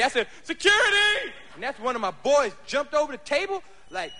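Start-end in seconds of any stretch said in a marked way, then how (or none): 0.4-1.3 high loud call for help; screaming for security. Retold or imitated. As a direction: yell it across the club. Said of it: "I screamed for security".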